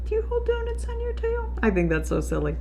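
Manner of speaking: ordinary delivery, nothing marked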